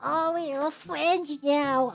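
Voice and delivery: very slow, high hideous creature voice; a creepy little creature; Gollum creature voice. Retold or imitated. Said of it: "I like how I'm using that voice, like he's, like, some fucking hideous, like, Gollum creature".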